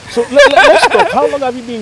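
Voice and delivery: bursting, high and loud; keen host launching into questions; excited. Real, not acted. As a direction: jump in eagerly, pitch way up. Host eagerly starts the interview.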